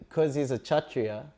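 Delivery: usual speaking voice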